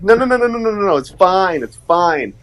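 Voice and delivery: high, rattled-off string of no's; imitated hurried dismissal; hasty reassurance. Acted hasty dismissal of a worry.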